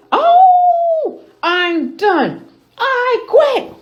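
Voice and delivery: very high, loud fed-up cry; exasperated queen. A narrator's reading. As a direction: throw up your hands and quit. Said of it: "declared the queen".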